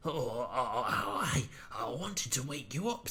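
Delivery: soft, halting, near-whispered; stammering. Acted. Bear nervously explaining to cross Dudley, stammering softly. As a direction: stumble over words, nervous. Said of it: "stammered Bear".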